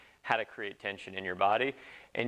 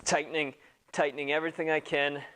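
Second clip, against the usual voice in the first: higher voice under muscle tension; straining with effort; 3 semitones higher than usual. Real, not acted. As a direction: talk while bracing the whole body. Demonstrating squat under full muscle tension.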